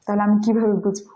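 ordinary talk, nothing marked

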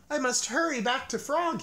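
high, urgent call; a high worried cry; anxious cry. A narrator's reading. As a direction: Toad hurries, calling out anxiously. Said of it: "he cried".

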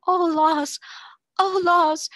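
high, loud rapturous cry; rapturous groan. A narrator's reading. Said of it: "sort of rapturous groan".

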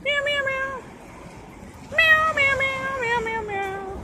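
high mock cat meows; playful high meowing. Repeated meowing.

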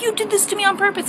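high, fast accusation; accusing mom. Retold or imitated. Mom accusing her daughter, high and fast. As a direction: blame her quickly and shrilly. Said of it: "She's like".

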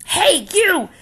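high, loud shout at a stranger; bully's call. Acted. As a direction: pick a fight from across the room. Grouchy ladybug picks fight.

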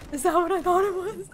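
excited disbelief. Real, not acted. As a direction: ask it urgently, voice lifting. Disbelief at reveal, building.

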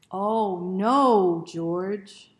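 big loud dramatic cry; loud exclamation. Shown as a demonstration. Reader leading children in the refrain, shouted loudly. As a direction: shout it out for the kids. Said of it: "remember those words?".

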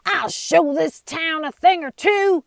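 loud, high outlaw command; ordering. Acted. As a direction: order it with menace. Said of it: "he ordered".

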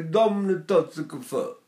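Neapolitan dark, swallowed vowels on "Dammi una tazza caffè". Retold or imitated. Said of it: "if you go to Naples".

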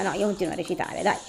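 usual speaking voice

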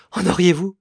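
high, loud sudden cry of hope; hopeful outcry. A narrator's reading. A grieving mother cries out for news of her daughter, high and loud. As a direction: leap at the news. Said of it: "s'écria madame Épernay".

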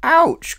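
high, loud yelp of pain; pained ouch. Acted. Little Miss Naughty yelping when her nose is tweaked. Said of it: "cried Little Miss Naughty".

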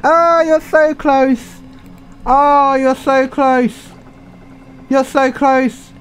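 drawn-out high hopeful cheering; will the boat over the line; high slow anxious cheer. Real, not acted. Gamer cheering his boat on, high and slow.

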